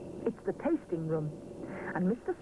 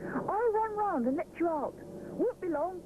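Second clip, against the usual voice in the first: eager little bear; 11 semitones higher than usual. A narrator's reading. Rupert voiced by narrator.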